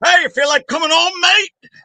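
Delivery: high-pitched, hammed-up British accent; cartoonish British imitation, rising at the end; terrible British accent. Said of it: "worst British accent ever".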